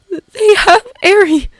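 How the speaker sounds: high, slow panicked wail; panicked cry. Acted. Canary panicking over Eri, wailing. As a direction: cry out in horror.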